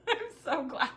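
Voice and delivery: laughing it out, almost tearful; laughing, touched. Real, not acted. Touched that a viewer joined her live, she laughs the words out. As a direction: let the laugh carry the words. Greets viewer joining the stream.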